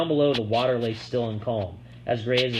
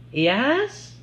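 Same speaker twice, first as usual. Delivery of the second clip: woman's voice; 13 semitones higher, 3 dB louder than usual. A narrator's reading. Woman answers door.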